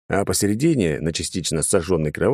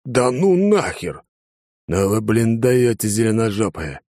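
admiring exclamation; 4 semitones higher, slower than usual. A narrator's reading. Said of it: "восхищённый голос Алекса".

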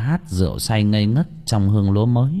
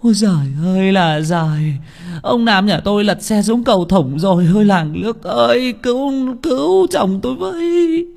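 shrill, ever higher wail of despair; wailing scream; 12 semitones higher, 3 dB louder than usual. Acted. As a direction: scream to the whole village for help, pitch soaring. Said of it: "Bà vợ ông hét lên".

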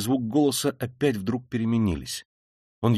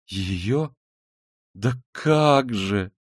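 very slow, pitch rising in pity; long compassionate wail of protest; plaintive, drawn-out; much slower than usual. A narrator's reading. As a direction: stretch each word out piteously. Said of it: "протянула Соня жалобно и с состраданием".

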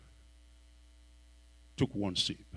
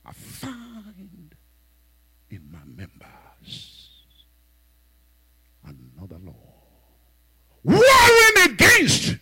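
scripture lament; 3 semitones lower, 6 dB louder, slower than usual. Real, not acted. Preacher quoting Paul, crying out on warring. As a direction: wail it like a lament.

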